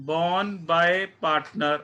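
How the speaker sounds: each word stretched out very slowly; slow emphatic. Shown as a demonstration. Teacher stressing the key term for the class, very slowly. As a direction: draw out the phrase word by word.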